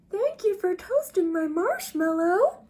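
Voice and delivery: a happy little dragon thanking its friend; grateful dragon. Acted. Dragon scene in drawings.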